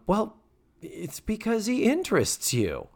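high, reassuring voice of wisdom; motherly advice in a lifted voice; Mrs. Garrett impression. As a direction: pitch it up and gently reassure. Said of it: "wisdom time".

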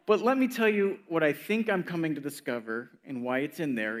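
normal talking voice

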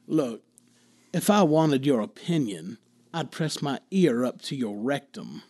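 slow, low tired Southern drawl. Pretend. Host showing co-hosts how Southern he can be, Southern drawl. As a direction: draw it out softly like a weary Southern sass. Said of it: "a kind of tired Southern drawl".

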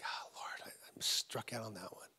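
low whispered confession, retold; barely voiced, low and rueful; hushed admission to God. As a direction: murmur it privately to the Lord. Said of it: "And I was like".

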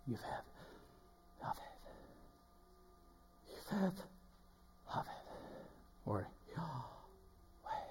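Yodhev Avavhe breathed out, almost silent; Yahweh breathed on the exhale, very soft; breathed name. Shown as a demonstration. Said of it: "you can breathe the name of God".

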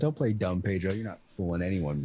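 low, stern warning; stern low quote. Retold or imitated. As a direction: deepen it and scold. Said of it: "Meg said".

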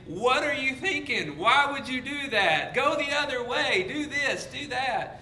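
high, loud hammed-up exasperation; mock-yell frantic advice at the screen. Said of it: "we're just yelling at the T".

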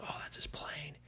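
hushed whisper of onlookers; whispered aside about the plane. Retold or imitated. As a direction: breathe it, no voice.

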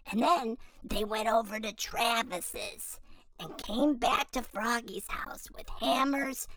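ordinary delivery

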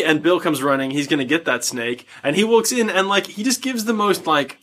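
normal talking voice

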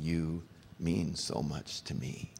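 slow, soft, deliberate delivery; almost mechanical. Shown as a demonstration. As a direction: say it slowly and quietly, word by word. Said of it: "you just need to almost be mechanical".

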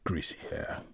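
low, whispered smirk. A narrator's reading. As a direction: mutter it softly with a smirk. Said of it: "he smirked".

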